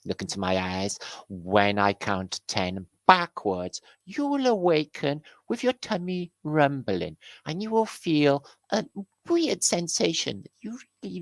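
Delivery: mock stage hypnotist. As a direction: lull them under, then snap awake. Hypnotist counting down.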